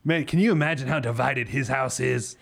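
a hint of an odd put-on voice; divided voice. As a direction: slip lightly into a silly voice. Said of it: "Why am I using this voice?".

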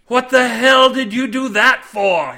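loud, high outraged cry; angry scream. A narrator's reading. Cade to the mates pinning him, screaming. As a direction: scream it at those pinning you. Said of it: "he screamed at his mates".

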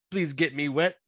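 ordinary talk, nothing marked

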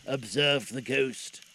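ordinary delivery

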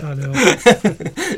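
laughing while saying it; amused laugh through the words. Real, not acted. Laughing about Geordie accent email.

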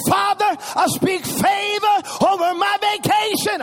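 put-on six-year-old boldly praying in the park; bold child prayer. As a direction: declare it like a tiny preacher. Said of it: "And he said".

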